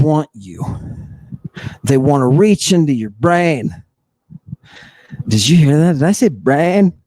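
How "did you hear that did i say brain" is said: normal talking voice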